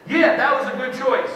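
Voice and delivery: laughing mock approval; mock praise. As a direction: tease the fake teacher praise. Teacher praising child, mocked.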